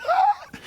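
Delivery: high mock gasp of shock at the table; astonished gasp. Telling of watching Wally draw on the tablecloth, mock gasp. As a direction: gasp like a stunned young kid. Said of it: "I'm just like".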